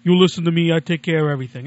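loud, brash Brooklyn tough guy; Brooklyn accent. Retold or imitated. The email helper promising to fix everything, loud Brooklyn accent. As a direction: reassure like a loud Brooklyn wiseguy. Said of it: "And he said".

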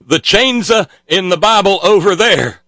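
high, hammed-up preacher echo; mock preacher. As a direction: mimic the preacher's cadence. Mimicking the preacher's clip.

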